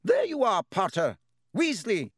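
high, loud call across the hall; stern professor calling out; voice ringing out. Acted. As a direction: call the boys to stop them in their tracks. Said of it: "a voice rang out".

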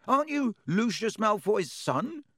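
with dislike. A narrator's reading. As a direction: needle him. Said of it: "looking at Malfoy with dislike".

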